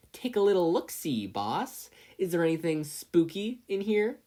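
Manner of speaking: high, loud mocking goon; taunting crony. A narrator's reading. Crony egging on Bakugo, high and mocking. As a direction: egg the boss on with a sneer.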